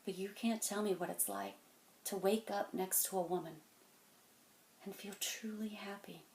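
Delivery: softly, fading to a near whisper; quiet intimacy. Acted. Telling a kid about waking beside a woman, hushed. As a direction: drop your voice and let the last words almost vanish. Tender turn in monologue.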